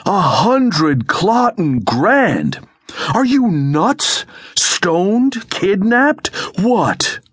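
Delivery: very high, drawn-out incredulous outburst; explosive outrage. A narrator's reading. Ida hears the size of the loan, exploding. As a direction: explode in high-pitched disbelief, stretching the words. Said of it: "Ida exploded".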